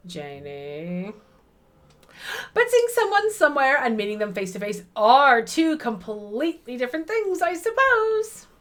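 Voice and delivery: bright Midwest mom, rising high at the end. Acted. Janie greets Petra in a gushing Midwest mom voice. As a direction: gush like a friendly Midwest mom. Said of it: "Midwest mom voice".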